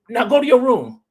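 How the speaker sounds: high, loud angry command; a father shouting the boy off to his room; dad's order. Retold or imitated. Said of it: "started yelling".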